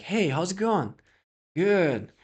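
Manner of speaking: bright mock greeting of a gym receptionist; imitating front desk girl. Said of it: "would talk to me like".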